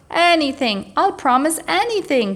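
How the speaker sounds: high, loud desperate plea; tickled dragon pleading for mercy. A narrator's reading. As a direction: beg breathlessly to be let off. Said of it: "pleaded the dragon".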